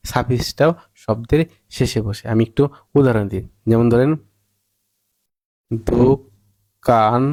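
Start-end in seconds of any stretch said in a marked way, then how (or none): 5.9-7.2 drawn-out demo word. Shown as a demonstration. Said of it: "এর সাথে আমরা ঠিক যোগ করলাম দার".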